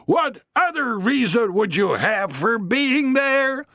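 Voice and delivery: high, slow, theatrical accusation; accusing matron. Acted. Matron denounces Harry, slow and theatrical. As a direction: denounce him to the whole pub.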